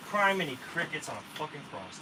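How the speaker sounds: an exasperated outburst; exasperated curse. Real, not acted. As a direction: let the frustration out at full voice. Louder frustrated repeat of curse.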